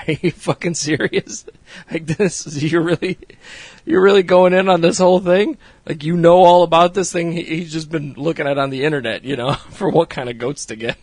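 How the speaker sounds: mock Jay's stunned disbelief; incredulous. Jay reacting to his goat-obsessed friend, disbelieving. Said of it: "Jay's just looking at him like".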